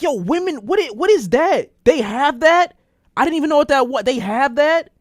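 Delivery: high, amazed disbelief; high-pitched stunned yo women. Retold or imitated. Retelling own amazed reaction.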